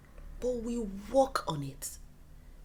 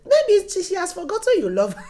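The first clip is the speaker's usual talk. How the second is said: put-on excited child; 10 semitones higher, 14 dB louder than usual. Teasing dad as a child.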